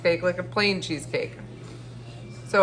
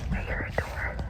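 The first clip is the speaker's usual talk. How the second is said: tense hushed whisper; 6 dB quieter than usual. Real, not acted. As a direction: whisper a warning to yourself. Sneaking, hears a door.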